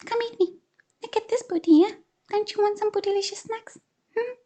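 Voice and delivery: very high teasing mongoose voice; taunting mongoose. Acted. Mongoose taunting the snake with his booty, teasing. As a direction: tease the snake cheekily.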